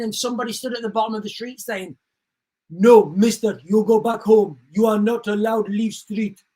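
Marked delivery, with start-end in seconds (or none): 2.7-6.4 mock broken English accent, louder at the start. Streamer imitating a migrant officer in mock broken English accent. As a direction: bark it like a foreign street guard in broken English. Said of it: "like, a broken English accent".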